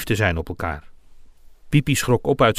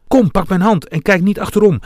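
raised, louder urgent command; urgent urging; 7 semitones higher, 5 dB louder than usual. A narrator's reading. Dwarf pulls Regenboog away from the fire, urgently. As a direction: urge a friend along, high and pressing.

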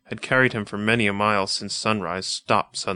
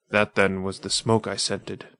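usual speaking voice

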